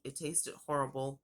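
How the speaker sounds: ordinary delivery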